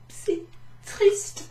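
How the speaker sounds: high, hammed-up sad whimper; mock sad wail. Shown as a demonstration. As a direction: act heartbroken for the class. Adjektiv traurig vorgespielt.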